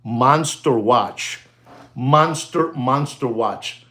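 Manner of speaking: drawn-out, weighty repetitions; slow, savouring emphasis. Real, not acted. Presenter showing off a monster watch, slowly savouring it. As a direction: stretch each MONSTER and WATCH right out.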